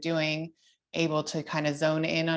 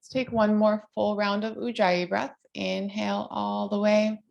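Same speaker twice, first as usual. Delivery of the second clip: higher, gentle guiding cues; yoga instructor voice; 4 semitones higher than usual. Acted. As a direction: lead the class through poses calmly.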